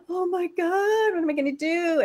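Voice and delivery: retold oh-no moment, pitched high; mock panic. Retelling own panic at son's cake request.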